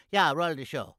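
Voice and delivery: ordinary talk, nothing marked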